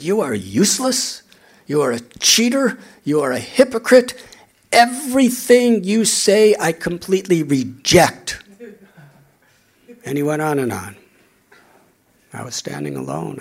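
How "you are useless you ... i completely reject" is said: loud, higher accusing shout; angry shouting. Retold or imitated. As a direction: yell the accusations louder and louder. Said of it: "He began to scream at me".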